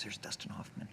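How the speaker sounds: hushed whisper to her brother; a retold sister's excited whisper; whispered aside. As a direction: whisper it so he won't overhear. Sister spotting him in restaurant.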